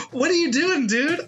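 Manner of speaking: high, laughing disbelief; real giggling incredulity. Real, not acted. Laughing retelling baby staring sunward.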